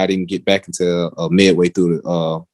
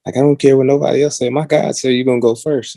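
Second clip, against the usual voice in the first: higher-pitched, insistent mom; lightly voiced mom; 6 semitones higher, faster than usual. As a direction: lightly imitate an insistent praying mother. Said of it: "She's like".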